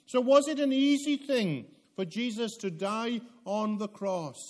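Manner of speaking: high, loud pulpit preaching; full-force preaching. Acted. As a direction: drive it home from the pulpit.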